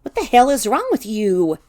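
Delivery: loud, exasperated demand. A narrator's reading. You confront Teddy's different voice, exasperated. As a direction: demand an answer, at the end of patience. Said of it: "tired and exasperated and scared".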